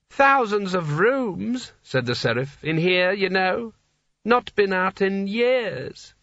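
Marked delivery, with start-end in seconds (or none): 0.1-1.7 put-on high, very slow, dreamy old ruler; tipsy old Seraph. A narrator's reading. Drunken old Seraph speaks. 2.7-6.1 put-on high, very slow, dreamy old ruler; tipsy old Seraph. A narrator's reading. He hiccuped.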